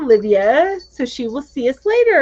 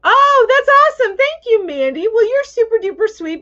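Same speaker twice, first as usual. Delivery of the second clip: high, loud delighted thanks; excited; 10 semitones higher, 4 dB louder than usual. Real, not acted. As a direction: gush with delight. Thrilled at viewer's kindness.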